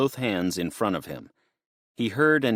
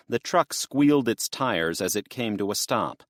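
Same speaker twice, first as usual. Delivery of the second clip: plain reading voice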